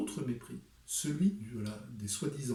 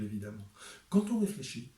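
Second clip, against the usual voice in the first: ordinary talk, nothing marked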